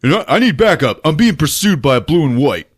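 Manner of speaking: a hint of action hero on the radio; lightly voiced radio call. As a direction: act out calling for backup. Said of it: "Dolph gets on the radio".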